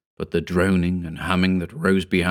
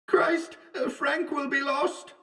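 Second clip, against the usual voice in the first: high, soft, slow distant lament; lost Wells; 8 dB quieter, slower than usual. A narrator's reading. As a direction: call weakly through the fog. Wells calls from the fog.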